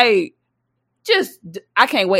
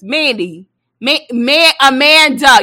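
louder, higher calling of a name; mama calling out; 5 semitones higher, 6 dB louder, slower than usual. Retold or imitated. Mama summoning daughter by full name, loud call. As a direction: call her from the other room, mock impatient.

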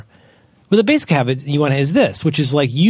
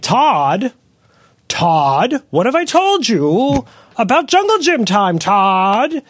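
hammed-up scolding parent, pitched way up; exasperated parent; 9 semitones higher, much slower than usual. Parent scolding Todd off the jungle gym, high mock yell. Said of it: "Where you go like".

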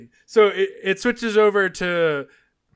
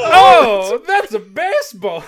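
excited exclamation; 5 semitones higher, 9 dB louder, slower than usual. Retold or imitated. Echoing the line.

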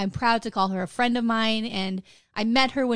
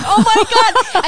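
much higher and louder gasp of dread; mock horror; 11 semitones higher, 13 dB louder than usual. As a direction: react like the worst just happened. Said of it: "I was like".

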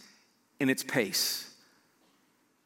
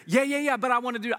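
high, louder eager protest; 6 semitones higher, 3 dB louder, faster than usual. Retold or imitated. Runner protesting to Jesus he wants to keep going, eager. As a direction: brush off the warning. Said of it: "You're like".

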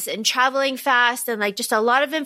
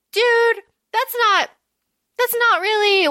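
exasperated dude; 8 semitones higher than usual. Real, not acted. Reacting to Gale's line.

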